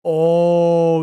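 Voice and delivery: ordinary delivery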